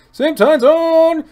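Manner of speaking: high, slow exclaim; excited. Real, not acted. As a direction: cheer it out. Excited about same time zone.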